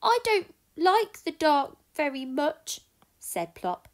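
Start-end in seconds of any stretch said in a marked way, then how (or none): 0.0-2.8 high little owl voice; a small owlet admitting his fear; baby owl. Acted. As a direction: owlet confessing fear of the dark. Plop the owl speaks.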